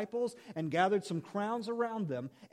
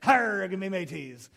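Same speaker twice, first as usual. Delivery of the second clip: slow, growled pirate "arr"; 5 dB louder, slower than usual. Pretend. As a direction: ham it up like a pirate captain. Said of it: "did the piratey voice".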